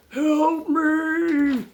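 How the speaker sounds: high, drawn-out mock holler; imitated yell for help. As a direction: imitate a man calling out from the woods. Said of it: "you can still hear him going".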